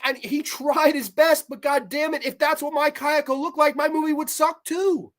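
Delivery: indignant outburst on the filmmaker's behalf; worked-up frustration. Real, not acted. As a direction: vent hotly, punching key words. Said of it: "I get fucking a little too worked up sometimes".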